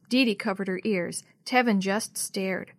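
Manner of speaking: plain reading voice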